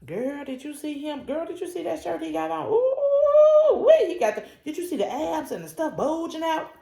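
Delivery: high, hushed gushing gossip with a squeal; gossiping girlfriend. Retold or imitated. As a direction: gush about a guy to a friend. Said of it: "when you start talking about".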